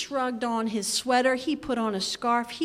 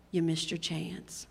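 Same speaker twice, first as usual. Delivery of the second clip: quiet rebuke; 7 semitones lower, 8 dB quieter than usual. Acted.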